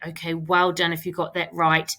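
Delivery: usual speaking voice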